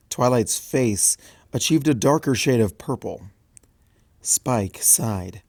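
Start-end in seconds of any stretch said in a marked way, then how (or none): none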